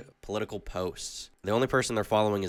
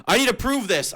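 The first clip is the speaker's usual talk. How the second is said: higher and louder, a hammed-up indignant cry; mock outrage; 13 semitones higher, 8 dB louder than usual. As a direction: push it up into fake fury. Ranting about thumbnail slander.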